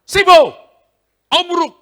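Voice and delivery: high, very loud yell; shouted rebuke. Retold or imitated. Preacher shouting at the possessed man, very loud. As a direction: bellow the words at him. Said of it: "Saya bilang".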